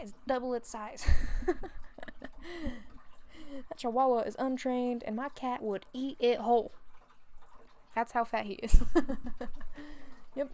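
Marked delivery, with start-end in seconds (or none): 1.1-3.6 loud laugh. Real, not acted. Laughing about fat cat. 5.3-8.8 laughing. Real, not acted. Laughing about fat cat. 8.9-10.3 high bursting laugh; loud laugh. Real, not acted. Laughing about fat cat.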